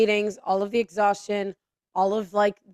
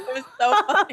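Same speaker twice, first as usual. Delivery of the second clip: higher, amused and breaking into laughs; laughing; 4 semitones higher, 4 dB louder, faster than usual. Real, not acted. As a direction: say it through real laughter. Laughing at the memory.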